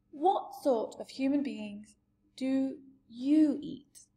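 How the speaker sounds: a touch of trembling; trembling question. Acted. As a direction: ask it with understated fear. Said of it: "she asked, trembling".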